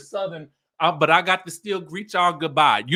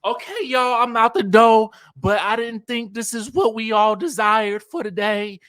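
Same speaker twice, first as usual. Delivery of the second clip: higher, louder mock farewell; mimicked Melody; 5 semitones higher, 6 dB louder than usual. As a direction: announce a dramatic exit in imitation. Acting out Melody's drawn-out southern goodbye.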